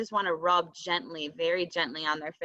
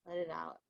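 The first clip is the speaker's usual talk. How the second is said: breathing out; 3 semitones lower, 13 dB quieter than usual. Shown as a demonstration. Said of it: "So breathing".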